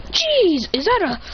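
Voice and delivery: high, acted surprise; startled exclamation. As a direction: exclaim as if caught off guard. Character startled high in air.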